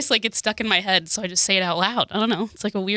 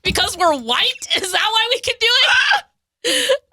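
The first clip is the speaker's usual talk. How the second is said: high-pitched; 12 semitones higher than usual. Real, not acted. Nervous joking about appropriation.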